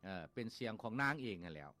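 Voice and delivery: usual speaking voice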